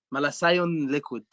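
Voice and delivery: Egyptian accent, "malathion" with the th said as s. Shown as a demonstration. Teacher showing students the Egyptian way of saying it, Egyptian accent. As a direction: say "malathion liquid" the Egyptian way, malasion. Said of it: "In Egyptian accent".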